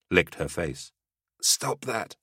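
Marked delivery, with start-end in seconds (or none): 1.4-2.2 hoarse, wheezy voice; wheezing. Acted. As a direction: wheeze it from a seared throat. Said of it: "she wheezed".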